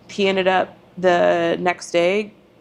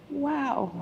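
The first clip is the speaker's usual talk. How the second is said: soft, higher, touched; appreciative wow; 6 semitones higher, 8 dB quieter than usual. Retold or imitated. Wife thanking husband for the coffee, soft. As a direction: breathe it out in gratitude. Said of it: "just so appreciative".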